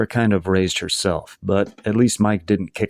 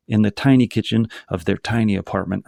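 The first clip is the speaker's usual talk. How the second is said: ordinary delivery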